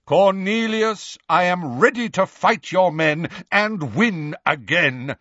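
stately warning, each word drawn out; thunderous. A narrator's reading. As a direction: thunder it out as a challenge. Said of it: "said Dumbledore in a thunderous voice".

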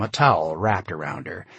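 ordinary delivery

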